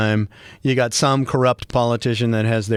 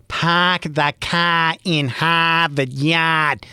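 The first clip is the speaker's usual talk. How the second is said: put-on slow, higher, overdone Boston accent; bad Boston imitation; 4 semitones higher, much slower than usual. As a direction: imitate outsiders badly faking Boston, stretching every vowel. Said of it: "that's my Boston imitation".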